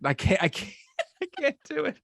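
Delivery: real giggles while speaking, pitched high; helpless high-pitched laughter in the words; laughing. Real, not acted. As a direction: laugh through giving up. Giggling over failed accent attempt.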